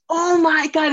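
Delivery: loud, high retold exclamation; explosive mock amazement; mind-blown outburst. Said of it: "And his head, like, explodes".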